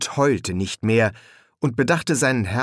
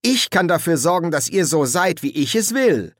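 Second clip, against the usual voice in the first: higher, emphatic boast of power; condescending; 7 semitones higher, 3 dB louder than usual. A narrator's reading. Boy threatens to reshape his friends, condescending and imperious. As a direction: assert your power over them loftily. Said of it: "sagte Adam herablassend".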